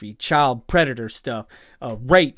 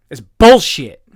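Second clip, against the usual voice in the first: louder, disgusted, fed up; angry outburst; 11 dB louder, slower than usual. Real, not acted. Reaction host to viewers, loud disgusted outburst. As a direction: spit it out in real disgust.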